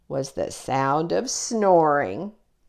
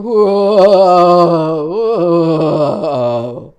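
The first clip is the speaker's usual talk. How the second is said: terrible monster moan; 10 dB louder than usual. Acted. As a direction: moan like a monster to spook the campfire. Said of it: "made a terrible monster moan".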